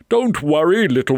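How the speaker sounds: animated storybook reassurance; reassuring rescuer. Acted. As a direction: comfort a hurt little bird, brightly projected. Rescuer soothes a hurt bird.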